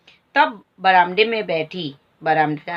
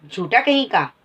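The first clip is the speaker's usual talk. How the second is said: angry accusation; 3 semitones higher than usual. Acted. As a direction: call the boy a liar. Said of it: "मालती कहती है".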